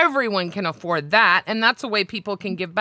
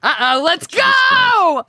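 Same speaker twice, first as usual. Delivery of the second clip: high, loud, drawn-out cheer; excited hype shout; 10 semitones higher, 6 dB louder, much slower than usual. Real, not acted. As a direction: belt out a stretched rallying shout. Hyping up the performance.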